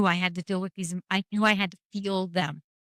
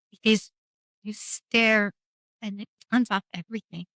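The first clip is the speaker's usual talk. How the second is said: ordinary talk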